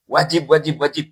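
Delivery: high and loud, three times over; emphatic proclamation. Retold or imitated. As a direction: hammer the repeated word home. Translates the triple declaration as loudly. (seruan tegas dan lantang)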